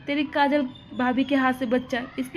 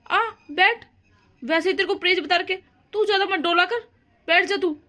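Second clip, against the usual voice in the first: raised, higher scolding voice; 5 semitones higher than usual. Acted. Mother scolding daughter to sit, raised voice.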